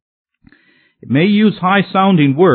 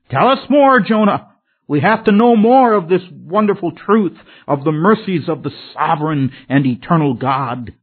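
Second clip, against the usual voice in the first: eager plea; 3 semitones higher than usual. Retold or imitated. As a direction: beg eagerly for more teaching. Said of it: "They probably couldn't get enough".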